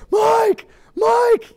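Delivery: very high, loud mock yell; urgent calling. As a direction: call desperately without leaving the spot.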